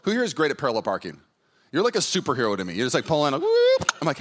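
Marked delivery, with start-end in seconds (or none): 3.4-3.9 high swooping whoop; a quick, high-pitched whoop; whoop sound effect. Retold or imitated. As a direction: whoop like a car zipping into the spot. Imitating car pulling in smoothly.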